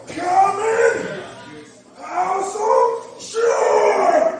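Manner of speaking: high, drawn-out anime shout; anime hero battle cry. Pretend. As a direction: scream it like an anime hero's big attack. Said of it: "do that whole stretching move and go".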